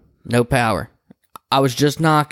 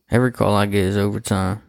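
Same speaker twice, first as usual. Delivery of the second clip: ordinary delivery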